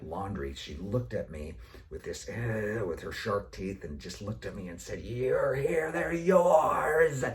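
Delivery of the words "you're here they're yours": high, loud and fed up; exasperated snap. Retold or imitated. Wife handing the kids to her husband, loud and exasperated. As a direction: dump the kids on him with a snap.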